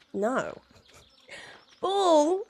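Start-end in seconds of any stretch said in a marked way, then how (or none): 1.8-2.4 "Bull" with a short rounded vowel, drawn up at the end; vowel demo. Shown as a demonstration. Streamer demos "Bull" for chat, rising. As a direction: say "Bull" slowly as the animal. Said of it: "like the one with the horns".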